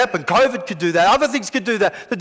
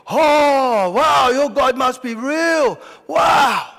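amazed crowd shout; 4 semitones higher, much slower than usual. Acted. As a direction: cry out in wonder at the miracle. Crowd amazed by fire from heaven.

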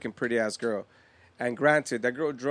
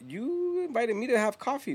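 retold disbelief, pitched way up; indignant protest; 10 semitones higher than usual. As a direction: lean on YOU in protest.

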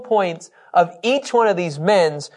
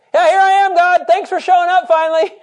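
much higher and louder, cheeky mock relief; sarcastic Abraham; 13 semitones higher, 5 dB louder than usual. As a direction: tease God for being late. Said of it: "he's doing it".

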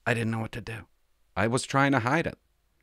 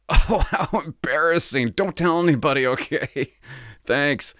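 embarrassed laughing; 5 semitones higher, 5 dB louder, slower than usual. Retold or imitated. Embarrassed plea to the cop.

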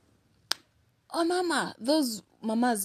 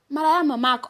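retort; 5 semitones higher, 6 dB louder, faster than usual. Retold or imitated. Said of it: "Niko tu".

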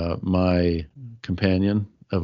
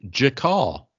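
American accent Spanish; 4 semitones higher than usual. Shown as a demonstration. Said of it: "If I said it like a gringo".

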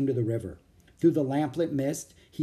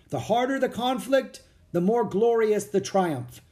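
higher, ringing proclamation, slowing on the triumph; commander reading aloud; 8 semitones higher, 3 dB louder than usual. A narrator's reading. Commander-in-chief reading the general's words to soldiers, proclaiming. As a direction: read the speech out to the troops. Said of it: "the commander-in-chief read on".

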